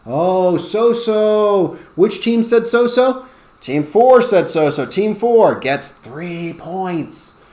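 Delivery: loud, high game-show reveal; excited reveal. Shown as a demonstration. Teacher revealing the answer, excited and loud. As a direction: announce the winner with big excitement.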